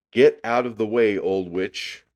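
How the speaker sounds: high, loud shout, easing at the end; yelled order. A narrator's reading. Boy yelling at an unknown witch, loud order. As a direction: yell to clear the path. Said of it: "yelled at Ramona".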